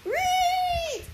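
high, loud guinea pig squeak; protesting squeal. A narrator's reading. Trapped guinea pig protesting to the sorceress, angry squeal. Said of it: "I protested".

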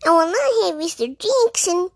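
high, firm, prim refusal; indignant mouse. A narrator's reading. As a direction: refuse him, high and sniffy.

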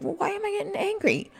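high, quick puzzled question to herself; puzzled self-question. Retold or imitated. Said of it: "and I'm like".

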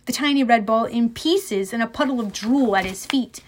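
ordinary delivery, nothing marked

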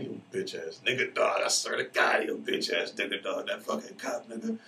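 real irritation spilling into cursing at the cop; angry cursing. Real, not acted. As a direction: vent at the cop who ticketed you. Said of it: "I get angry when I think about that shit".

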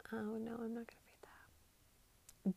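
soft, slow whisper; whispered refusal. Real, not acted. Vlogger whispering refusal to read a tweet. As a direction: back away from reading it, hushed.

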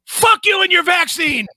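hammed-up rage, screaming the line; mock furious shout. Cohost mocking a hothead, shouting in fake fury.

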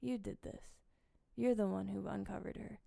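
hushed, measured accusing tone; very soft, each charge set apart; quiet accusation. Retold or imitated. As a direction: imitate a stunned husband accusing under his breath. Husband stares and accuses his wife.

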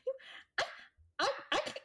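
high, halting bashful voice; blushing Jeb. Acted. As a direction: stammer as if too shy to refuse. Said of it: "blushing, and he's like".

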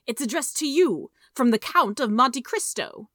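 loud, high accusing cry. A narrator's reading. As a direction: cry it out as damning proof. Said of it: "he cried".

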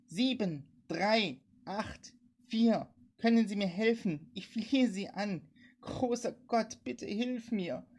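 drawn-out, high-pitched begging; pleading woman. A narrator's reading. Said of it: "flehte die Frau".

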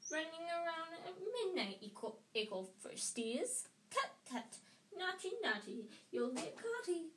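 high, singsong taunting imp; mischievous Peeves-like falsetto; cackling poltergeist. A narrator's reading. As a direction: tease them in a gleeful high singsong. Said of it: "Peeves cackled".

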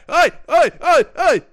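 high, loud rapid-fire yells; hammed-up repeated hollering; mock shouting. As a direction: yell it with every imagined smack. Acting out smacking a driver.

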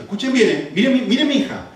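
usual speaking voice

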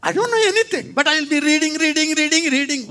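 put-on high, singsong voice of a clueless young reader; naive teen reader. Teenager reading Ephesians blindly, high singsong. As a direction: play your younger self reading blindly.

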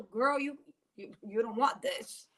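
ordinary delivery

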